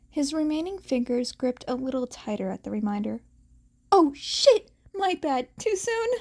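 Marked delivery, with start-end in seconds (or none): 3.9-6.1 higher, louder "oh shit" blurted out; a sudden gasp of regret, voice jumping up; startled blurt. A narrator's reading. Friend blurting an apology after a tactless joke, startled.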